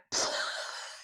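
quiet puffed-out laugh at own slip; short voiceless giggle; breathy splutter of laughter. Real, not acted. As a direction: splutter after tripping up. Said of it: "ja też mam problemy z tym słówkiem".

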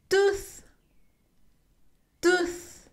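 tooth with the tongue out, blowing air. Shown as a demonstration. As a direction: push the tongue between the teeth and blow on tooth. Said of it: "Para pronunciar la T H ... lengua y soplar".

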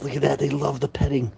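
normal talking voice

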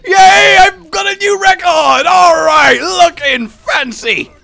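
high, loud celebration; gleeful cheering. Real, not acted. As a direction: cheer a new record at full voice. Celebrates a new record.

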